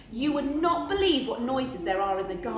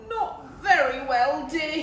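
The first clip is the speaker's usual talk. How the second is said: yawning; 5 semitones higher, 4 dB louder than usual. A narrator's reading. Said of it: "he yawned".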